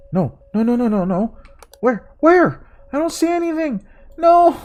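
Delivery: high, frantic panicked voice. Real, not acted. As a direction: panic as if caught out. Warning appears, panicked search.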